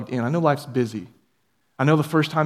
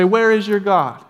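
higher, slow taunting question; mocking world voice; 5 semitones higher, 5 dB louder, slower than usual. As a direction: sneer the question. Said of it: "will say".

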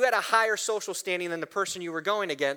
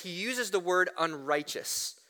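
ordinary delivery

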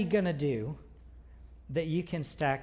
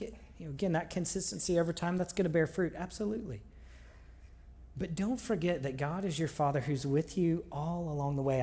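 ordinary talk, nothing marked

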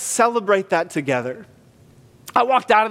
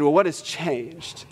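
gentle; 4 dB quieter, much slower than usual. Real, not acted. Pastor repeats question softly.

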